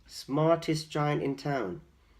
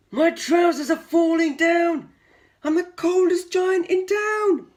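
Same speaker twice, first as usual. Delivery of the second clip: much higher, louder cry; giant's panicked shout; 11 dB louder than usual. Acted. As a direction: yelp in alarm, very high. Giant cries out in cold.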